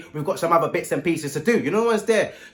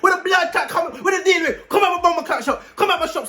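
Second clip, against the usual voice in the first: mock angry yell; 10 semitones higher than usual. Shopkeeper yelling at the customer, mock angry. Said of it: "My man switched on me".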